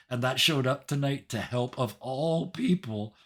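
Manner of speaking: ordinary talk, nothing marked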